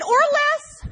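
snapped way up high; high dry quip. Retold or imitated. Daughter cuts into Rosie's question with a high quip.

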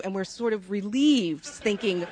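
normal talking voice

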